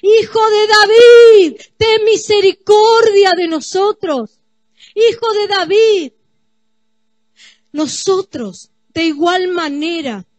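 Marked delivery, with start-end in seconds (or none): 0.0-6.1 clamor fuerte y agudo, dando voces; gritando. Acted. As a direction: grita como un ciego que suplica misericordia. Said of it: "Ellos gritaban". (gritando con voz alta y suplicante)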